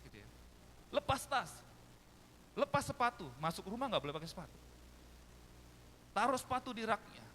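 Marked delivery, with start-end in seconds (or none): 0.9-3.3 high, forceful commands; imitated mother's loud orders. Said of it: "suaranya bisa menembus tembok-tembok rumah". 6.2-7.3 high, forceful commands; imitated mother's loud orders. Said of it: "suaranya bisa menembus tembok-tembok rumah".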